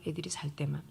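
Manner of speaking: unvoiced whisper, hushed; breathy secret whisper; whispered aside. Crying secretly while children sleep.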